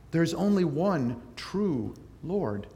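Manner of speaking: ordinary talk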